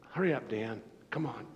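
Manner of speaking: usual speaking voice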